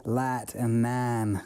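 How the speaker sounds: long, slow Texas drawl on "lat and nan"; slow Hollywood-Texan twang; Hollywood rancher drawl. Shown as a demonstration. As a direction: stretch the vowels like an East Texas rancher. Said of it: "rancher kind of Hollywood version".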